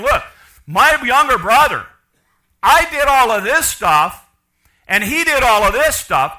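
loud, high, slow resentful complaint; indignant older brother. Retold or imitated. Older brother complaining to father, loud and resentful.

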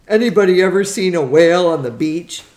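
loud, quick question to the table; asking loudly. Acted. Alice asking strangers loudly about a whale. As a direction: call it out to the fogies fast. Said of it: "Alice asked".

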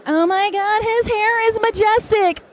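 high, slow, swooning gush; swooning fangirl. Real, not acted. Fan staring at David Tennant from the line, high slow swooning gush. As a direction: gush over his hair, starstruck. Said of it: "I was literally hyperventilating".

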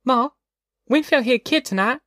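put-on high-pitched child telling on her brother; small high tattletale voice; tattling little girl. A narrator's reading. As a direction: pipe up to mother like a little girl. Narrator voices young Ruthie.

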